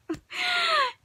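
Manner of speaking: a real squealing half-sob laugh; high crying laugh. Real, not acted. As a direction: break into a high teary laugh. Crying laugh after repeat.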